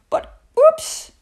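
plain reading voice

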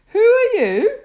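gentle, high little voice; kindly. A narrator's reading. Teddy kindly asks the wet stranger, a gentle, high little voice. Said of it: "asked Teddy Bear kindly".

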